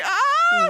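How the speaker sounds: sharp high scream of terror; loud high shriek; panicked scream. Retold or imitated. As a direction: shriek like teeth are falling out. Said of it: "and I'm like".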